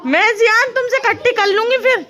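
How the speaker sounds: high, loud scolding; scolding threat. Real, not acted. Mother threatens child refusing tuition, scolding loudly.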